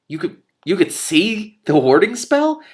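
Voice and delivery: high, slow astonished question; startled. Acted. Telemaine to Mendenbar, startled and high. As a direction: gasp it out in disbelief. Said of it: "looked and sounded considerably startled".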